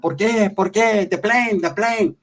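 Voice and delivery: small high mock voice; little guy. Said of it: "The little fucker comes out".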